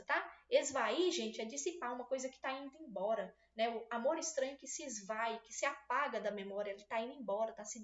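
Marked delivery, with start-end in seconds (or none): none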